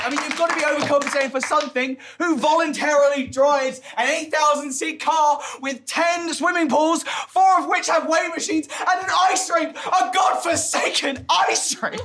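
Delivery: high, loud, escalating comic tirade; mock-incredulous rant. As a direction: build to an outraged shout. Mock-incredulous rant about the ship.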